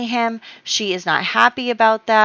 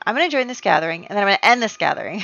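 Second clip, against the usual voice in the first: ordinary delivery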